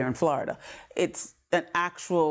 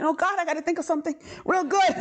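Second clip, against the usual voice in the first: high, fast panicked thought; a high, fast kid's panic; 10 semitones higher, faster than usual. Retold or imitated. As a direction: rush it in a panic.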